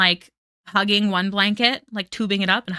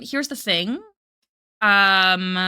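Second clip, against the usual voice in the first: ordinary delivery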